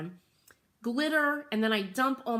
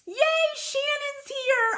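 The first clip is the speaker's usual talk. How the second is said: excited greeting; 5 dB louder than usual. Real, not acted. Greeting viewer arriving.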